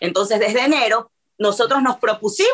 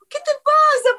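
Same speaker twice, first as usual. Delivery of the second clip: imitated worried husband. Husband finds her crying, voiced.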